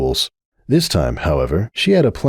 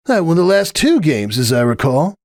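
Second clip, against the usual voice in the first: raised, self-satisfied brag; smug boast; 8 semitones higher than usual. A narrator's reading. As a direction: rub the last win in her face.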